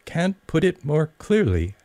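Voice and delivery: put-on high, polite little girl; polite Alice. A narrator's reading. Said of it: "Alice replied very politely".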